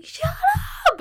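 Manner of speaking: mock yell from a distant neighbor; playful imitation of yelling; retold lady yelling. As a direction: imitate a woman yelling to silence someone. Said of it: "you hear a lady yelling".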